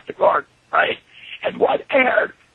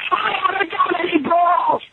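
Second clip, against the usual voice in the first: shouted denial, raised pitch; angry Vince; 7 semitones higher than usual. Retold or imitated. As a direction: yell it as the offended boss. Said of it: "Vince goes".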